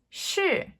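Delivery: "shi" starting high and falling; fourth tone shi. Shown as a demonstration. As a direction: drop "shi" sharply from high. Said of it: "fourth tone".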